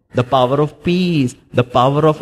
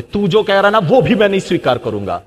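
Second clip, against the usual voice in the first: higher, rough mock shout; mock yelled reply; 4 semitones higher than usual. An angry man yells his refusal, mock shouting. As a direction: snap it back like a hothead. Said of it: "आप वहां चिल्ला कर बोल सकते".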